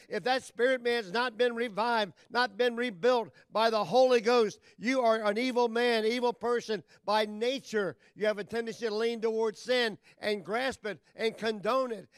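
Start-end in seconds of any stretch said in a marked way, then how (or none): 0.1-10.0 louder, driving sermon push; preaching at full force. Acted. Preacher warning his congregation, rising and insistent. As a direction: warn the flock with rising force.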